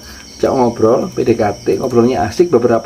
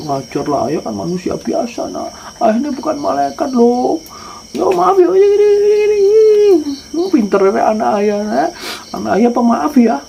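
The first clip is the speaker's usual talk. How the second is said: coaxing, sweet-talking father; 10 semitones higher than usual. Acted. Father coaxing child, high voice.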